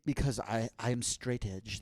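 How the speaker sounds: measured, self-important villain; supervillain voice. Pretend. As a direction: announce yourself as a mock supervillain. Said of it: "carried away sometimes with your voice".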